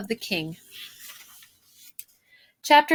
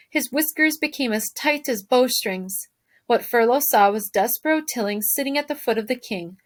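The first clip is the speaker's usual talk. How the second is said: plain reading voice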